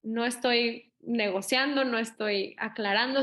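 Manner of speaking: ordinary delivery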